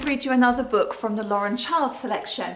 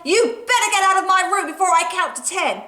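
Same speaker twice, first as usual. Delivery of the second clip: fast, high, louder warning; fed-up threat; 8 semitones higher, 4 dB louder, faster than usual. Acted. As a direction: rush out the threat at the pest.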